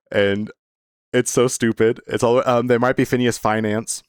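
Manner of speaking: ordinary delivery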